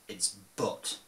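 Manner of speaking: ordinary delivery, nothing marked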